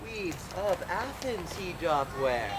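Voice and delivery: ordinary talk, nothing marked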